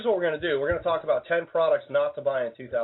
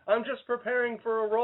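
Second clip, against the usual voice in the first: high, innocent excuse as the actor; 9 semitones higher than usual. Retold or imitated. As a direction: pitch up as a caught actor making excuses. Bale caught in crack house.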